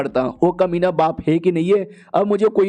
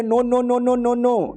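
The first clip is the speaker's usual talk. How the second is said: put-on higher, girlish string of no's; whiny girlish refusal; 5 semitones higher, much slower than usual. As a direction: protest like a pouting girl. Girl refuses to change clothes. (ऊँची, रूठी हुई लड़की की तरह मना करना)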